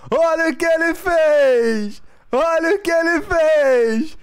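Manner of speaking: drawn-out, loud, high goal call; explosive goal commentary. Pretend. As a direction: belt it like a TV narrator. Mock narrating own goal.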